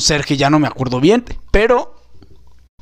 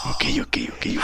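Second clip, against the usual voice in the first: whispered agreement; 11 dB quieter than usual. Acted. Nephew whispers back to uncle.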